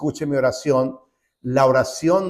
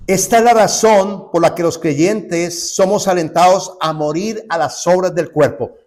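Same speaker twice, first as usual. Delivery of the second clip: slow, louder and higher pulpit exhortation; preaching at full force; 5 semitones higher, 8 dB louder than usual. Acted. Preacher urging believers to die to the flesh, at full force. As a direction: exhort the flock with weight.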